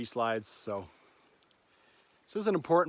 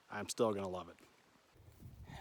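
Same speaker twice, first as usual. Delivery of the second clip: quiet resolve; 4 semitones lower, 7 dB quieter, much slower than usual. Real, not acted. Resolving to keep going.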